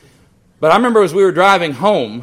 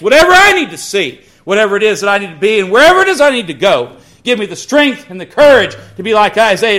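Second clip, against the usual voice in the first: loud, high, climbing preaching; fervent preaching; 3 semitones higher, 4 dB louder than usual. Acted. Pastor leading the congregation in Isaiah's prayer, fervent preaching. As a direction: build to a ringing "Send me".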